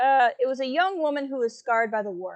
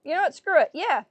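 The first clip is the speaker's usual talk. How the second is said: exasperated outburst; 6 semitones higher, 7 dB louder than usual. Real, not acted. Streamer gives in loudly.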